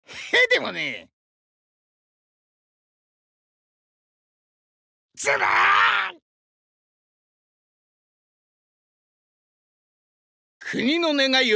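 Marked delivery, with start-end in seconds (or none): 5.2-6.2 high, drawn-out roar of effort; long battle cry. Acted. Wrestler throwing all into the bout, long battle cry. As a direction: bellow it out on one long breath.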